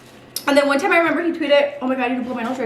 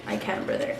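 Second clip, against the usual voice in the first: breathy unvoiced joke reply; whispered tease; 11 dB quieter than usual. Teasing partner, joking reply.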